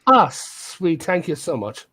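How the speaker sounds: ordinary talk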